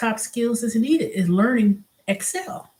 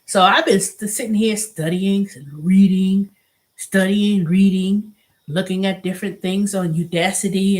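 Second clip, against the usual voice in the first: ordinary talk, nothing marked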